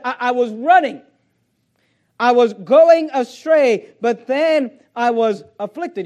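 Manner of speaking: loud, slow, punched scripture quotation; full-force preaching. Real, not acted. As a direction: preach it out loud, drawing out each phrase. Preaches in Jonah's words.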